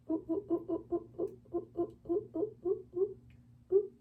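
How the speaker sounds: soft, high nervous oohs under the breath; nervous cooing. Real, not acted. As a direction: coo anxiously while snipping. Nervous cooing while cutting hair.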